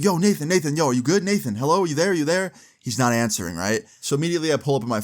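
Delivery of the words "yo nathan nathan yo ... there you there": panicked calling. Retold or imitated. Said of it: "I start tweaking out".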